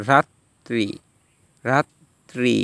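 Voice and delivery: slow রাত্রি as "rat-tri", t held double; doubled consonant. Shown as a demonstration. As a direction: split রাত্রি between the two t sounds. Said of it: "দ্বিত্ব উচ্চারণ মানে দুইবার উচ্চারণ হবে".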